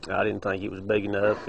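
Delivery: normal talking voice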